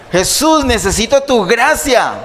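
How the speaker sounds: high-pitched imagined plea; hypothetical appeal, cried high; mock crying out. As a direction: call out as the blind man begging. Imagined cry of the blind man.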